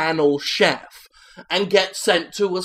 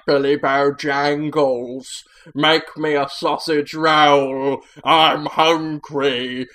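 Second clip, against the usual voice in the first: scary teacher; slower than usual. Acted. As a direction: demand it slowly and darkly. Scary teacher orders the boy.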